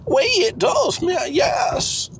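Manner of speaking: high-pitched, stubborn character voice; Cecil, insistent. Acted. Plays Cecil.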